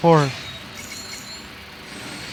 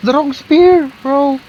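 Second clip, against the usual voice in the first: frustrated; 4 semitones higher, 7 dB louder, slower than usual. Real, not acted. Wrong item pulled.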